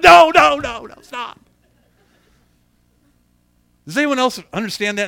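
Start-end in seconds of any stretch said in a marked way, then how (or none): 0.0-1.4 high yell, fading at the end; hammed-up panicked protest; frantic parent. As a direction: desperately shut the child up. Acting parent trying to stop child.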